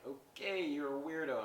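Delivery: mock a politely freaked-out reaction; weirded-out. Shopper backing away from Noelle, weirded-out. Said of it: "she's all kinda freaked".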